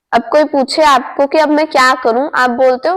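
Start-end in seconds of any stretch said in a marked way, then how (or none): none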